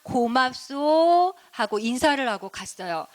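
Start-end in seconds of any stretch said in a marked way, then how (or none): 0.0-1.3 very slow, drawn-out thanks; lightly acted granny voice, rising slowly; storybook granny. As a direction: stretch it out like a grateful old woman. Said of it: "그래서 할머니가".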